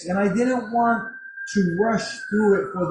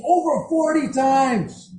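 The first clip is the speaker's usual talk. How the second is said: high, slow, excited imitation; fired up; 7 semitones higher, 4 dB louder, slower than usual. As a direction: draw out the amazing count, imitating her thrill. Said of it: "She was getting me all fired up".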